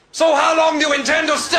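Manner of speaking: high, shouted border-guard demand; mock stern officer. German passport officer questioning an arrival, stern mock shout.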